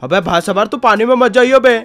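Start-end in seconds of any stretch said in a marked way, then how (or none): 0.0-0.7 थोड़ी नीची, खिंची आवाज़ में कुत्ता चॉप; "यार" पर ऊपर उठती टोन वाला चॉप; Chop dog voice. Pretend. (मज़ाकिया चॉप की हल्की सी आवाज़)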